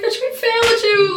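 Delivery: high, loud, tearful-sounding cry; mock wail. Dorm chief to trainee after failed plan, high mock wail. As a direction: wail it in exaggerated dismay.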